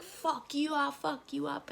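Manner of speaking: high, thin mock threats; imitated angry crowd. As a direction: mimic inmates shouting from a distance. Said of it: "everyone's just freaking out outside".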